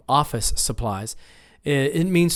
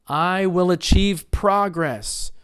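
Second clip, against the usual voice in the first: slow, higher model sentence, "progress" as PROgress; first-syllable stress; 5 semitones higher, slower than usual. Shown as a demonstration. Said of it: "nouns on the first syllable".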